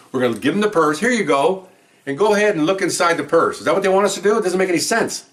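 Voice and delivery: mock the ruling with exasperation; sarcastic rant. Real, not acted. Officer rants to the judge, exasperated sarcasm.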